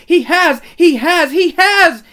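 very high, loud little squirrel; frantic squeak. A narrator's reading. Young squirrel loses its head before the witch, frantic squeak. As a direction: squeal it over and over, hysterical. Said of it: "it squeaked".

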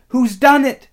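very high, loud demand; crying out. A narrator's reading. Susan at the broken table, crying out. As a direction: call out the question in distress. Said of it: "cried Susan".

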